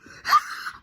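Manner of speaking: sharp, loud gasp of joy; excited tearful gasp. Real, not acted. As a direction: gasp as if the news just landed. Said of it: "More tears".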